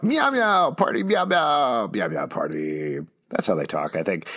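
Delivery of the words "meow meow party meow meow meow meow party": high meowing cat voice; cat meows. Retold or imitated. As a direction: meow like a party cat. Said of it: "they go a little something like this".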